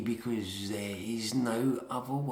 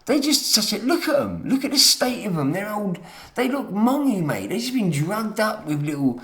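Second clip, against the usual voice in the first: high, loud contemptuous ranting; angry rant; 7 semitones higher, 7 dB louder than usual. Real, not acted. Raging about drugged-up older people, loud and scornful. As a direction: sneer and shout it out.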